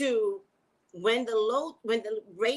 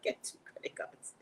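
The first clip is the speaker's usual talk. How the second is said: breathy whispered aside; whispered tip; 12 dB quieter than usual. Real, not acted. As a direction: whisper it to the viewers as a secret.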